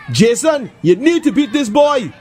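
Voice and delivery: high, cheering call; lightly voiced fan yelling encouragement; crowd shout. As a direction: egg him on from the crowd. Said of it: "someone in the crowd shouts".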